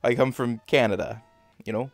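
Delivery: put-on thick Canadian hoser accent. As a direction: lay on the folksy Canadian accent, with "eh". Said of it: "have an accent like this".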